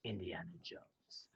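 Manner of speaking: low, slow, breathy whispered name; villain whispering the hero's name; ominous whisper. Retold or imitated. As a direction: hiss the name like a villain who already knows. Villain recognizing the hero.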